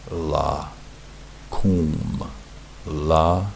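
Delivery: "La", pause, "kum", each drawn out separately; syllable by syllable. Shown as a demonstration. Teacher introduces a Hebrew verb, syllable by syllable. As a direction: sound out "La" and "kum" as isolated syllables. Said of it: "that's la".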